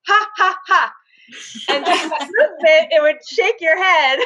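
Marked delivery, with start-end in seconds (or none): 0.1-0.9 three separate, higher-pitched mock laughs; forced "ha" laughs. Shown as a demonstration. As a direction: pop out each "ha" on its own. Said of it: "first person would have to go".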